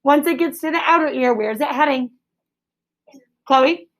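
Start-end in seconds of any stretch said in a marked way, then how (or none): none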